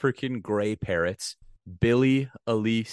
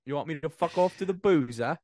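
mock British accent; 8 semitones higher than usual. Continuing British parrot bit.

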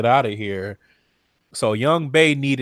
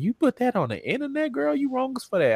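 mimicking Jada; 11 semitones higher, 3 dB quieter than usual. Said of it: "she's like".

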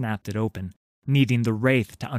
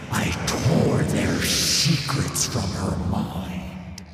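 slow rasping ghostly whisper; a sinister wraith savouring torment; cold hissing layered whisper; slower than usual. Acted. Thresh's voice.

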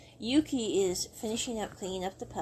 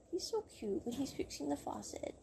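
imitating a secret thought, much softer and higher; hushed, higher-pitched swooning aside; hushed admiration; 5 semitones higher, 9 dB quieter than usual. Yuki watching Mister Lai fix the faucet, hushed admiration.

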